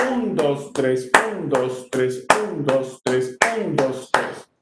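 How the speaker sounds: accented triplet count; first beat stronger. Shown as a demonstration. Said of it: "La primera es más fuerte porque tiene acento".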